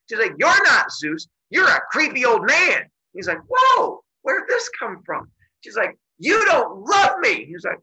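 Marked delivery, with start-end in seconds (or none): 0.4-2.9 loud, high, indignant retort; acting out a furious lover's accusation; angry accusation. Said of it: "she gets angry and angry". 3.5-5.3 startled. Retold or imitated. Zeus startled by her outburst. 6.2-7.4 high, loud, drawn-out complaint; acting out a hurt, furious lover; angry accusation. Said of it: "she gets angry and angry".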